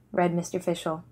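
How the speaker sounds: plain reading voice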